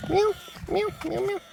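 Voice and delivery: tiny high cat meows, quiet; soft high meows. Acted. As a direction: meow softly like a kitten. Meowing at the cat.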